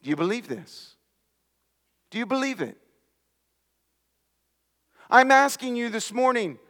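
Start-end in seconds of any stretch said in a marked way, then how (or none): 0.0-0.9 soft question. Shown as a demonstration. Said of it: "listen to how he ends it". 2.1-2.8 soft question. Shown as a demonstration. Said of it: "listen to how he ends it".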